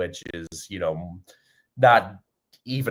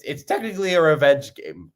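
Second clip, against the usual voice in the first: voice crack; 8 semitones higher, 4 dB louder than usual. Real, not acted. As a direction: voice cracking on high pitch. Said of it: "Holy voice crack".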